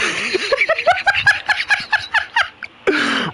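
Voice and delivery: very high burst of laughter; squealing high laugh; big laugh. Real, not acted. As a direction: crack up at the endless list. Laughs after the long list.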